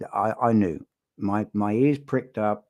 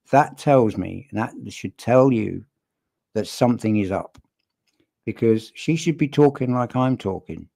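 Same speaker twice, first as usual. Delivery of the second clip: normal talking voice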